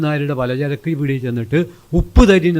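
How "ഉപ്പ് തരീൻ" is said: raised, higher call for salt; asking for salt. Retold or imitated. Young man at the grocery asking for salt, raised call. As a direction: call it out to the shopkeeper, pitched up.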